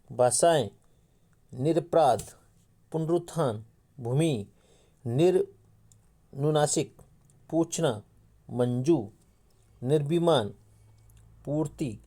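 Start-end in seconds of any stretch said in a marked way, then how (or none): 5.1-7.0 slow dictation. Shown as a demonstration. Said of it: "teacher dictating a word".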